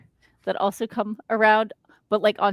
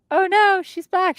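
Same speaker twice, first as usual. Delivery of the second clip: high, louder playful panic; mock alarm; 10 semitones higher, 5 dB louder than usual. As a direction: cry out in pretend dismay. Acting out chatter caught slacking.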